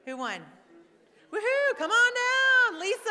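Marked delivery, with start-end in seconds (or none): 1.3-1.8 excited cheer. Real, not acted. Winner announced. 1.8-3.1 loud, high, drawn-out host call; game show announcer. Retold or imitated. Calling the winner up.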